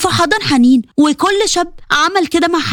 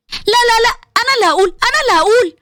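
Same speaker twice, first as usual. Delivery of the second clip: higher, eager protest; eager insisting; 6 semitones higher, faster than usual. Acted. Bushra insisting she will tell the story, eager and high. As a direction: jump in, begging to tell it.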